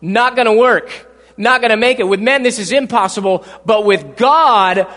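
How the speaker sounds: loud, slowing preacher's climax; booming sermon close; full-force preaching. Acted. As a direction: drive it home to the congregation.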